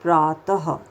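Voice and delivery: प्रातः with a short ह breath at the end; breathy final h; visarga h ending. Shown as a demonstration. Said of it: "तो क्या बोलेंगे".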